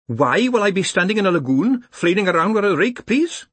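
high-pitched polite protest; querulous professor. Acted. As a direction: question the orders in a high voice, lifting at the end. Penny Smart questions the task.

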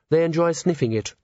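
mildly horrified correction; quietly horrified. A narrator's reading. Host explains brandy etiquette to a crude guest, quietly horrified. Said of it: "said a quietly horrified chair".

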